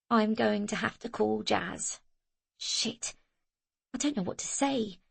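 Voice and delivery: anxious hush. A narrator's reading. Zoe anxious, hushed.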